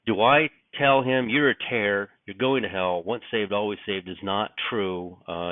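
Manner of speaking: ordinary talk, nothing marked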